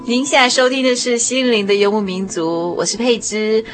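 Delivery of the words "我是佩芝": normal talking voice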